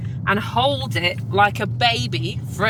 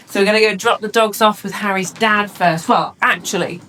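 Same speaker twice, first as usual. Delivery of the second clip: normal talking voice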